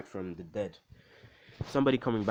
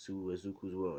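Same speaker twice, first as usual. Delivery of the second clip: plain reading voice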